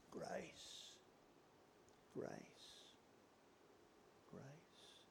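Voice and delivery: soft whispered word, repeated. Real, not acted. Preacher answering the voice of doubt, whispering. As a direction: breathe it out, fading.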